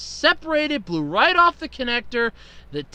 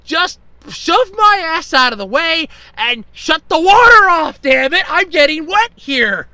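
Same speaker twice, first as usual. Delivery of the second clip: exasperated rant; 4 semitones higher, 7 dB louder than usual. Retold or imitated. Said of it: "I'm thinking to myself".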